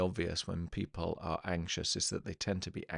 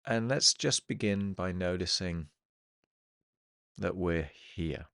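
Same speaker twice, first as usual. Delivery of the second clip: guided meditation. Acted. Hypnotherapist settling listeners into the present moment, guided meditation. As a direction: ease them into the present, phrase by phrase, leaving long silences.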